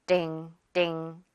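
띵 repeated low, with no puff of air; tense 띵. Shown as a demonstration. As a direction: say 띵 tight and low, as a model. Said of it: "띵이 되는 거죠".

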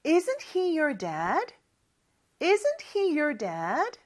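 very slow rising question, twice; slow drill. Shown as a demonstration. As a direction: lead the repeat slowly. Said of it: "그쵸?". (천천히 두 번 따라 하는 연습)

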